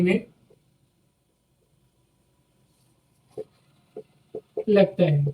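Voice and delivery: slow dictation, each word spaced for note-taking. Shown as a demonstration. Teacher to students: slow dictation.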